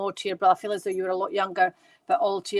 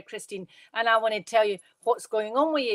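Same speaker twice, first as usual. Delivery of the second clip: usual speaking voice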